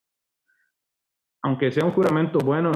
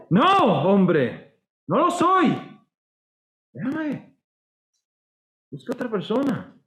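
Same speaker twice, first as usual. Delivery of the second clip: panicked denial; 7 semitones higher, slower than usual. Acted. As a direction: start shouting high then drop to a pleading hush.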